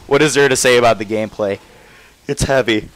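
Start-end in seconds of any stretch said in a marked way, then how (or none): 2.3-2.9 words stretched by a yawn; mid-yawn, half-swallowed words; said through a yawn. Real, not acted. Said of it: "Ugh, sorry, yawning".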